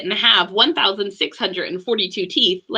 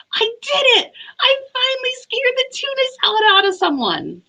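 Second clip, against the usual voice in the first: high, excited cheer; 13 semitones higher than usual. Acted. Monster Leonardo cheering after scaring Sam. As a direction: cheer in triumph. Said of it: "cheered Leonardo".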